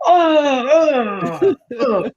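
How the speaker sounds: very high-pitched mock kid wail; high, drawn-out childish protest cry; sassy kid's holler. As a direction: mimic a little kid mouthing off at cars. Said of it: "sassy little cute kids in the street".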